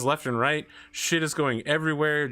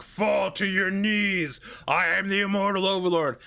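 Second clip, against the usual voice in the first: mock overlord; 6 semitones higher than usual. DM mocking the paladin's grand speech, hammed-up. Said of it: "you being like".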